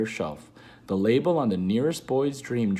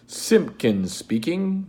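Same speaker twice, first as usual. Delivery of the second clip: slow, higher, pompous phone greeting; important telephone voice; 7 semitones higher, 5 dB louder, slower than usual. Retold or imitated. Father answering the phone, slow and self-important. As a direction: announce your name like a self-important father. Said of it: "his very important telephone voice".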